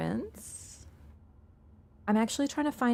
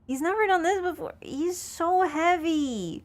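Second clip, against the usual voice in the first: strained under weight; 9 semitones higher than usual. Real, not acted. Strained under heavy cat.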